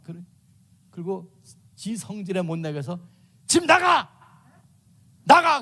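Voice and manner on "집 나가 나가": put-on high, loud furious shout at a child; hammed-up angry parent yelling 'get out'. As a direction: scream them out of the house. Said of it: "그러거든".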